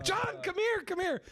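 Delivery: very high, urgent retold call; hyperventilating call. Calling John in from the other room, hyperventilating. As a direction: call out high as if panicking for help. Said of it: "I'm hyperventilating at this point".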